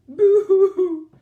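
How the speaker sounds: high, loud hammed-up boo-hoo cry; the lost baby dragon wailing for its mother. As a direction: wail like a baby. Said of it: "cried the baby dragon".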